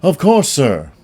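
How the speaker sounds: high, courteous butler voice; bow and answer politely. Acted. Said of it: "the butler bows".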